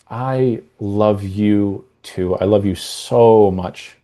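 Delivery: mean every word, slowly; tender and heartfelt. Shown as a demonstration. Spouse answers lovingly, slow and tender. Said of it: "that second version".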